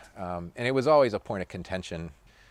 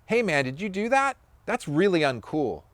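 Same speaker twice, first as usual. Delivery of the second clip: much higher, mock-disapproving reproach; scolding; 10 semitones higher, 3 dB louder than usual. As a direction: tell him off like a let-down friend. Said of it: "and says".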